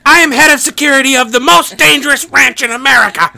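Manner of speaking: high, loud self-important tirade; mock outraged shout. Head of ranch security demanding his nickname, mock outrage. Impersonating the security chief's outburst.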